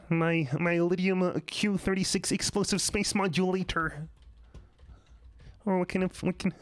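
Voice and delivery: Marvin the Martian. Retold or imitated. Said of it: "except Marvin the Martian".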